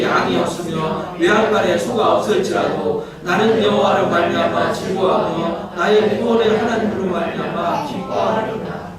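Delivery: measured pulpit scripture reading. Acted. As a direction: read it out like holy writ.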